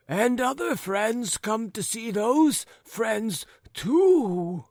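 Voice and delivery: put-on high, wavering old man's voice; chatty old man. Forgetful old man telling his neighbours of a woman, high and doddering.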